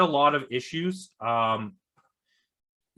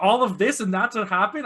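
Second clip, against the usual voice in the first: disappointed disbelief; 4 semitones higher than usual. Retold or imitated. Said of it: "We're like".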